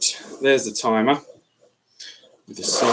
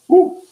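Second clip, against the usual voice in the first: sudden high whoop from losing balance; startled woo; 6 dB louder than usual. Real, not acted. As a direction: yelp as you nearly fall. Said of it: "I nearly fell over".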